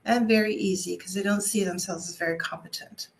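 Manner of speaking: ordinary talk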